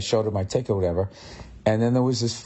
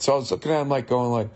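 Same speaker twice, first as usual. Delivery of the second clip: ordinary delivery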